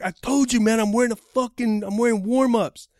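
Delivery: high, loud, exasperated insistence; retold screaming. Fighter describing his clothes to the driver, screaming. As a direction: imitate a fed-up caller yelling his answer. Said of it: "He's screaming at me".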